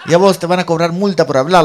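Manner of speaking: high, loud mock comeback; heated retort. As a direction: fire back at her in an acted quarrel. Él le replica en riña. (réplica acalorada imitada, a voces)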